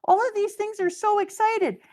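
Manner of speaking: very high, gushing fan voice; mock excitement. Fan gushing over the manga, very high excited voice. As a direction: squeal it like a thrilled fan. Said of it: "and you're like".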